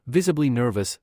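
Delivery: ordinary delivery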